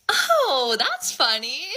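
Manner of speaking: high, loud, stretched fake lightness; feigned amusement. Girl reacting to her friend's screenshot, feigned amusement. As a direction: put on a high-pitched 'how funny'.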